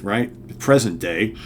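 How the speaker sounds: normal talking voice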